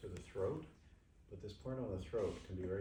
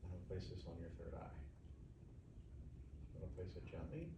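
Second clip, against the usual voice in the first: soft gentle murmur; hushed, calm care voice; soothing massage murmur; 10 dB quieter than usual. Real, not acted. Hushed session talk to client.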